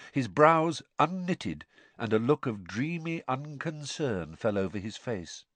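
ordinary talk, nothing marked

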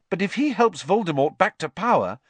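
high, loud worried protest; Harry, alarmed. A narrator's reading. Harry objects to the headmaster, in alarm.

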